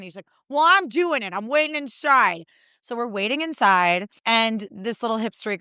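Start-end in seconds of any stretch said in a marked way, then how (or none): 0.5-2.5 higher, slow, put-upon dad voice; grumbling dad. Retold or imitated. Dad refusing to wait outside in the cold, grumbling. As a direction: complain like a stubborn father.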